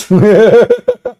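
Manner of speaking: loud, high bleating cackle; high-pitched laugh. Real, not acted. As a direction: cackle high and loud at your own joke. Laughing at own goat joke.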